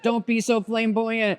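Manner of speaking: high, drawn-out imitation of wife; mock wifely scolding. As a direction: playfully imitate a wife's slow, high-pitched telling-off. Quotes his wife scolding him.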